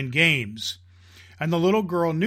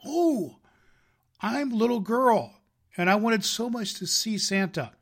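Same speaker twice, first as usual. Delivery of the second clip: put-on little girl voice; 7 semitones higher than usual.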